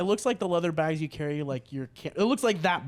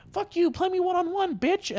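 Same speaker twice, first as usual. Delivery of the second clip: very high-pitched squeaky challenge; hammed-up high kid voice; mock cocky kid; 14 semitones higher than usual. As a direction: imitate a little kid talking trash. Mimicking cocky little sporty kids.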